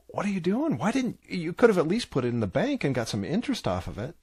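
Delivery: rebuking the lazy servant, pitch jumping high; an incredulous boss demanding answers; scolding master. Retold or imitated. Master scolding servant who buried money, incredulous.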